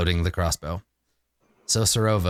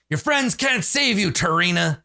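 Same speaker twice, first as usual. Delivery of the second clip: higher-pitched scowling threat; scowling villain taunt; 8 semitones higher than usual. Acted. Villain warns the woman he is about to attack, scowling. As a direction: taunt her through a scowl. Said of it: "he scowls".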